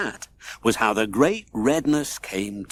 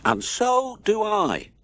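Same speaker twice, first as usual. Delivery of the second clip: moaning; 8 semitones higher, slower than usual. A narrator's reading. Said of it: "moaned the wizard".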